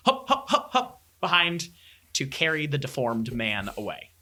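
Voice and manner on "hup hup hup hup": high rhythmic hups; heaving effort chant. Acted. Guards pulling in a cart, high effortful hupping. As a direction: puff out quick working calls, high-pitched.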